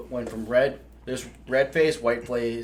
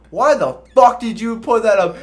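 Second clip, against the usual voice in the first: loud, high angry yell; angry drill sergeant; 10 semitones higher, 9 dB louder than usual. Retold or imitated. Drill sergeant yelling at the recruit who flicked cornbread. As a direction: chew the recruit out. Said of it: "He's like".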